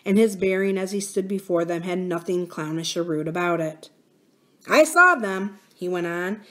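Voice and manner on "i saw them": put-on a young miner's plucky, louder voice; confident boy, pitched a bit up; lightly voiced miner boy. Miner boy telling nurse and princess what he saw, confident.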